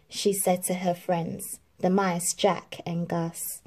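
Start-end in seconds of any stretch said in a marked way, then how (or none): none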